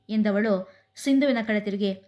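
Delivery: usual speaking voice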